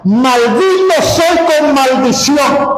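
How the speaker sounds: high, slow, drawn-out declaration; solemn scripture voice. Acted. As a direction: proclaim it like the voice of God. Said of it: "dice el Señor en su palabra". (citando la Biblia con voz solemne y lenta)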